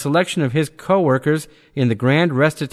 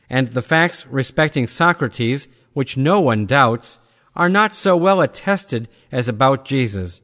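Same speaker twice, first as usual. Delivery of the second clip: ordinary talk, nothing marked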